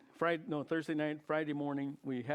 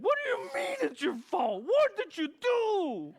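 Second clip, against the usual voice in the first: very high, loud, half-crying shout with a laugh in it; a shrill yelp at his friend, drawn out on the second question; alarmed outcry; 9 dB louder, slower than usual. Retold or imitated. To his friend Ben, an alarmed high shout. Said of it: "And I said".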